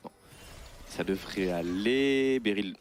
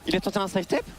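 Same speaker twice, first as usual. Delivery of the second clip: excited call; 8 semitones higher, faster than usual. Real, not acted. Hype caster calling a play.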